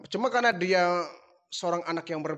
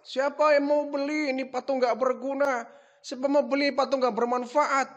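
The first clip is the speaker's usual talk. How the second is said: higher mock hawker cry; repeating the shout; 7 semitones higher than usual. [name] shows father how he shouted, high mock cry. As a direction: replay his street call. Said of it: "teriak-teriak apa tidak".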